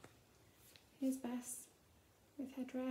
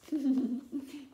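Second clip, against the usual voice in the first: laugh; 8 dB louder than usual. Real, not acted. Reader laughs at tongue image.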